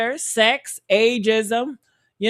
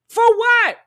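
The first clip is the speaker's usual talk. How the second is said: higher, louder demand; indignant outburst; 9 semitones higher, 6 dB louder than usual. Real, not acted. Host demanding a reason for the filming, loud and higher. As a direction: throw the question out in disbelief.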